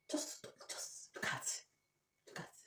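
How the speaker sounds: slow breathless whisper; despairing whisper. Real, not acted. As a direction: whisper it, drained.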